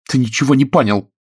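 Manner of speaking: high, loud woman's reproach; exasperated outburst. A narrator's reading. Actress reproaches Oleg at the door, exasperated. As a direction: hurl it at him as you leave. Actress storms out of lovers' quarrel.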